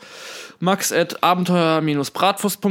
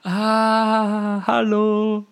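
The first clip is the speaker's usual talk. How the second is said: higher, dazed hello; dazed stoner greeting; 5 semitones higher, much slower than usual. Acted. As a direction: greet slowly, spaced out. Recognizing in bit.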